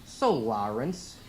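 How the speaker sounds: put-on slow Boston-accented interviewer; put-on Boston drawl. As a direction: imitate a Boston man, stretching the questions. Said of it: "I was up in Boston, and the guy looks at me and goes".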